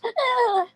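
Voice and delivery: scream. Retold or imitated. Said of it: "she goes".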